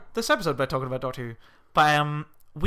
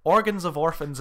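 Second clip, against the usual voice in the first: ordinary talk, nothing marked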